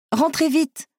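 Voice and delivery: urgent high-pitched call. A narrator's reading. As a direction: hurry them in with a high call. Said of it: "les appela du haut du pont".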